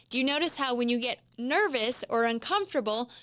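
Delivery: ordinary talk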